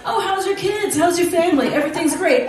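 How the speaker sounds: high, bright imitation of chatty customs officers; chirpy pleasantries in a high mock voice; mock cheery German small talk. Officers trading friendly greetings at customs, high mock-cheery. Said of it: "in German, they're like".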